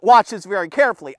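normal talking voice

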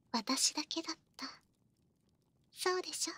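breathy unvoiced whisper close in. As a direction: whisper it possessively in the ear. 囁きで念を押す. (耳元で囁く)

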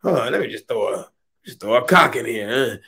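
ordinary talk, nothing marked

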